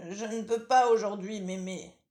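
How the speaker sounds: wolf pretending to be the granddaughter; wolf faking girl. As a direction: coax like a sly wolf playing innocent.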